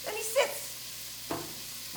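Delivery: "And he sits" stretched very slowly in a whisper; drawn-out whisper. Real, not acted. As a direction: drag "sits" out, mocking his laziness. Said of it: "and he sits and he eats it".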